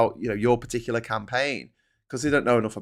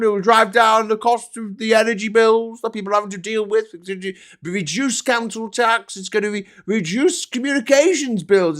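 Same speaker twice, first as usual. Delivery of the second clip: loud, high, sarcastic sales pitch; mocking rant; 8 semitones higher, 6 dB louder than usual. Streamer parodying politicians' promises about flags, mocking shout. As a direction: shout out absurd promises in mockery.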